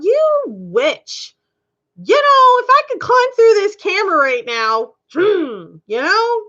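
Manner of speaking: heated high outburst at the viewer; angry retort. Retold or imitated. Talking back to the rude viewer, heated and high. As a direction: snap back at her, pitch climbing.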